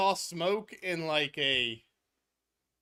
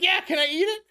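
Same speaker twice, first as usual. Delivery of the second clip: higher, louder eager question; Joey asking; 10 semitones higher, 5 dB louder, faster than usual. Retold or imitated. Friend Joey asking the others if he can eat it, higher and louder. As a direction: pitch it up and push, keen to eat it. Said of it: "Joey's like".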